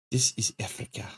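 breathy dramatic whisper. Acted. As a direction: whisper it like a movie tagline. Dramatic movie line.

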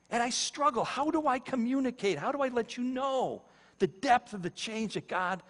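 high, earnest, struggling voice; emotionally stuck. Real, not acted. Preacher struggles to communicate his change to listeners, emotional and high. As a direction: plead high as you search for words. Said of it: "this is where I get stuck".

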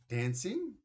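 high, rising disbelief; not buying it for a second; mother doubting her son's excuse, rising in disbelief; doubtful question. A narrator's reading. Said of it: "Mum didn't look convinced".

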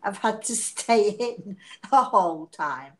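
ordinary talk

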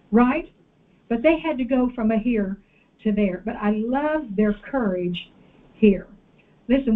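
ordinary talk

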